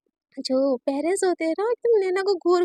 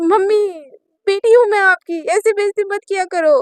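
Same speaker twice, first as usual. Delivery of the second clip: louder, higher, whining; whiny plea; 3 semitones higher, 7 dB louder than usual. Acted. Naina whining to her laughing mother, hurt plea. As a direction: sulk at mum.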